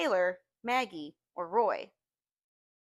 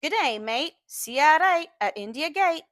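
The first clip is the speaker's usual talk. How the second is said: put-on a teen's practised Australian accent, louder and higher; 5 semitones higher, 4 dB louder than usual. Boy practising an Aussie accent alone in his room, mock Australian accent. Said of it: "practice speaking like an Australian".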